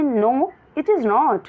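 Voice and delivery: slow, clear classroom read; slow reading aloud. Shown as a demonstration. Teacher reading the question to her pupils slowly. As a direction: read each word out very slowly.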